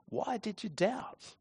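Jesus lifting Peter up, a tender reassuring question. Shown as a demonstration. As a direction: ask it with a smile and love. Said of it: "with a smile, with love and, and commitment and compassion".

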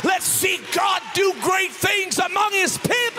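rally the crowd at full force; roaring rallying speech. Acted.

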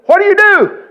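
high, loud shouted question; retold loud question. Preacher questioning a skinny kid onstage, loud question. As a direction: call it out over a huge crowd. Retells calling to kid on stage.

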